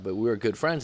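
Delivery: usual speaking voice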